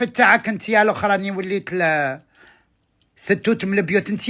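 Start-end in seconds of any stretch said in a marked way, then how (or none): none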